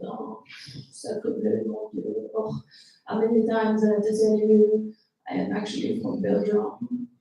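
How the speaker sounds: mock dramatic, French-accented sighing. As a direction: play an oversensitive French-sounding boyfriend. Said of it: "then what's with the accent?".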